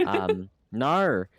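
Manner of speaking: "nar" as a drawled Aussie no; Australian "nar" for no; Australian accent. Retold or imitated. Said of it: "New Zealand, uh, Australian accent".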